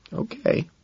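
tiny high, soft voice; small acted sniffly assent. A narrator's reading. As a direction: give in tearfully, very quietly. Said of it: "she sniffs".

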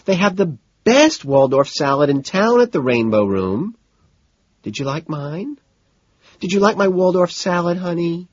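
high, whiny and coaxing; whiny woman. A narrator's reading. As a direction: keep the spoiled whine going. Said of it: "she whines".

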